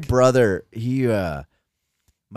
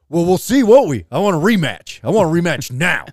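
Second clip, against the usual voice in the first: mock-indignant challenge, a bit higher and louder; defiant kid, imitated; 5 semitones higher, 5 dB louder than usual. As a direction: act out a riled kid demanding satisfaction. Brother demands a rematch, retold.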